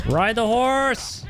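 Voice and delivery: high, drawn-out game call. Real, not acted. Calling out during game.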